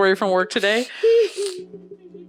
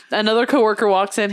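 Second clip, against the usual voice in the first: usual speaking voice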